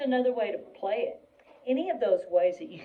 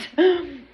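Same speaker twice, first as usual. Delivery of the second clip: quick audible breath in; gasp; 7 semitones higher, 6 dB louder than usual. Real, not acted. Teacher readying for a tongue twister, sharp inhale. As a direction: suck in a breath before retrying.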